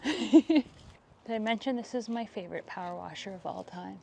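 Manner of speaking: ordinary talk, nothing marked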